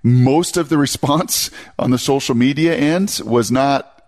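normal talking voice

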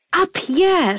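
bright, calling sun voice; the sun calling down kindly; warm beaming sun voice. A narrator's reading.

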